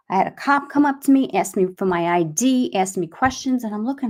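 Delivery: normal talking voice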